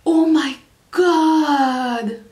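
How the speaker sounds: drawn-out, awestruck exclamation; émerveillement. Real, not acted. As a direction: stretch each word way out in amazement. Awe on seeing a blue jay. (exclamation émerveillée, très étirée)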